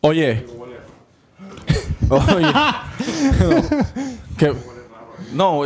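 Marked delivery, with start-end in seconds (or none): 2.5-4.3 high, real laugh; long laugh, pitched well above speech; burst of laughter. Real, not acted. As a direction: crack up helplessly. Cracks up mid-commentary.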